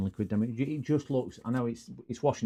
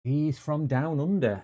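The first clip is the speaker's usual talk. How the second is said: slow, higher mock Australian accent; a clumsy Australian accent, drawn out slowly; attempted Australian accent; 5 semitones higher, slower than usual. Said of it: "I can't do Australian accents".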